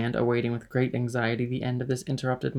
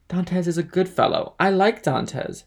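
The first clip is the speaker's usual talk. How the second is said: put-on high, loud drunken affection; tipsy fondness; 6 semitones higher, 5 dB louder than usual. A narrator's reading. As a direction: declare it like an affectionate drinker. Tipsy man declares fondness for Dantès.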